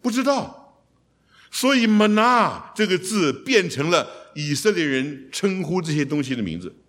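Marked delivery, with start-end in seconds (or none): 1.5-2.7 玛拿 said high and loud, stress on 拿; preacher hitting the word 玛拿; emphatic manna. Shown as a demonstration. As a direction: lift 玛拿 up high and punch it. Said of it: "希伯来文重音多半在后面".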